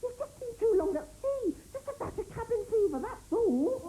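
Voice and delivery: high, coaxing reassurance; humouring. Acted. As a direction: humour him like a child. Humouring a pirate about cabin fever.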